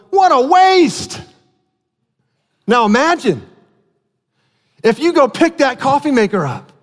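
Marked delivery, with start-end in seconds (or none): 0.1-1.2 high, slow mock dismay; a much higher, drawn-out sigh of disbelief; dismayed exclamation. Visitor seeing the coffee maker as doorstop, mock dismay. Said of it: "and you'd go".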